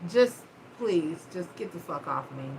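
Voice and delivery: quiet, drained plea to stop; weary pleading. Retold or imitated. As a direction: beg softly, fed up and exhausted. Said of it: "I was just like".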